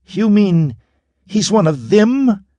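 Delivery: high, loud female cry; Narala crying out. A narrator's reading. Narala cries out to Quarn in fury. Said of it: "she cried to Quarn".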